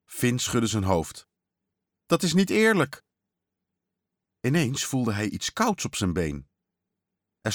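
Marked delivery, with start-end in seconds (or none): 2.1-3.0 put-on high-pitched childlike complaint; acted boyish indignation; indignant protest. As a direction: object as a cheated kid, voice pitched up. Boy protests the trick answer.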